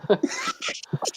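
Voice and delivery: squeaky burst of laughter; high squealing laugh. Real, not acted. Laughing at own impression.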